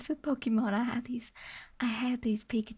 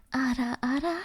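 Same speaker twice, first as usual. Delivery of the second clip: whispered coy "ara, ara" with a rising lilt, a bit louder; teasing anime ara ara; 4 dB louder than usual. Shown as a demonstration. Streamer redoing the ara ara for chat, coy whisper. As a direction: purr "ara, ara" like a playful anime older sister. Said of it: "That's a better one".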